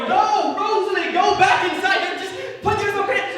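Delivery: loud, high scolding, laughing through it; a counselor yelling at a pantsless camper; exasperated yell. Retold or imitated.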